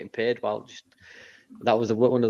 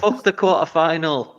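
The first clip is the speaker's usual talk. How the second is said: mock player grumble; 7 semitones higher, 6 dB louder, slower than usual.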